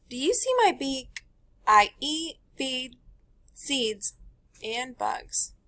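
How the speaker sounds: bright storybook read-aloud; teacher reading a picture book to the class; reading to children. Acted. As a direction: read it to a class of little kids.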